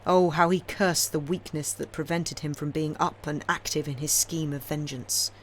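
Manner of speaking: ordinary delivery, nothing marked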